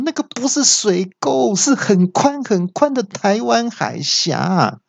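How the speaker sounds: 高八度的夸张抗议; 拔尖嗓门，后半慢下来强调; 模仿大叫. Retold or imitated. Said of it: "那我听到就大叫说". (对导航机高八度夸张抗议)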